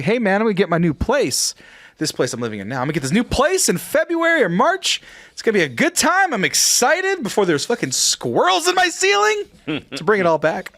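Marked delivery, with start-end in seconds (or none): 0.0-9.4 high, climbing burst of eager plans; retold excitement. Telling how he felt about his new place, excitedly retold. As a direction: relive the excitement, pitch rising as it goes. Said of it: "I was excited".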